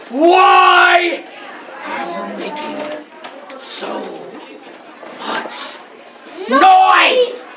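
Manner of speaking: loud drawn WHY, then hushed words, loud high NOISE; exasperated wolf. Acted. Wolf complains to the criers, exasperated.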